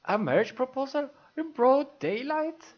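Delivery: scandalized villagers. Acted. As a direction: climb into scandalized disbelief. Gossiping villagers shocked.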